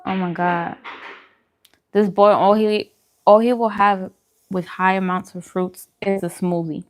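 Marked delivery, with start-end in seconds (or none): none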